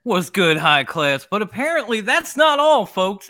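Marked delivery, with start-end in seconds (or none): 1.3-3.3 salesy pitch, higher; infomercial pitch. Acted. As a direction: sell it like a TV pitchman. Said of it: "Is this like an infomercial?".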